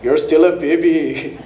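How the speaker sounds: low, slow put-on smooth talker; lightly voiced sleazy charmer, laughing; a hint of a slow creep line; mock smooth Indian guy. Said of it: "He's not like".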